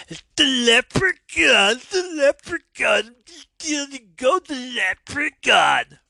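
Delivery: high shrieking leprechaun chant. Pretend. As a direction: screech it like a gleeful leprechaun. Sings leprechaun theme-like chant.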